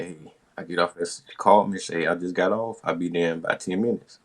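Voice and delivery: usual speaking voice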